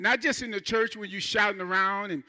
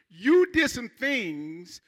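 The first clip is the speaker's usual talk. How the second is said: slow emphasis; much slower than usual. Acted. Preacher slowing for emphasis.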